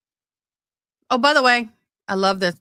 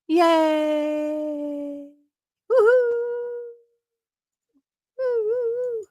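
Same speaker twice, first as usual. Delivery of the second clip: mock cheer; much slower than usual. Cheering National Frosted Cookie Day.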